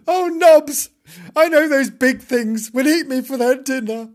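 high, loud wailing plea; frightened kitten. Acted. Kitten telling Nobs cars will eat him, frightened. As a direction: wail to a friend in mock terror.

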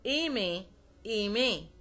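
إيمي with é at both ends, "ay-may"; French é sounded clearly in إيمي; closed E. Shown as a demonstration. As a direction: open إيمي on "ay", never "euh". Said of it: "وينطق مثل الإي في الإنجليش".

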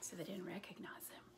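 soft, quick whisper; whispered aside. Real, not acted. Reader whispering to listeners that nobody knew him. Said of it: "reader's whispered aside".

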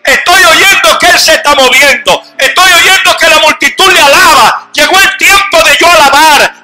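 loud, high, desperate blind man pleading to be heard; blind beggar crying out. Acted. Blind man crying out for Jesus, loud desperate shouting. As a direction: shout it out like a blind beggar who won't be silenced.

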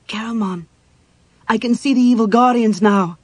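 high-voiced alarm, growing loud; frightened. A narrator's reading. Cleric sees evil guardians and calls warrior, frightened. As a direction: call to your protector in fear.

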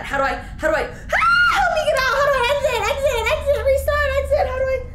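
high shouting panic; scared horror game streamer. Real, not acted. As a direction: scream for a way out.

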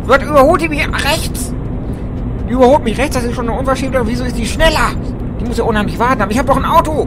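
high, loud protest; indignant outburst. Real, not acted. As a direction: complain loudly at being overtaken. Indignant at being overtaken.

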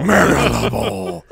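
mock menacing shark voice. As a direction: growl the name like a cartoon shark. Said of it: "Why is that a shark's voice?".

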